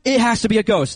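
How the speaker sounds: mock freaking out over a ghost; panicked disciples. Disciples seeing Jesus on water, hammed-up panic. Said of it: "They were like".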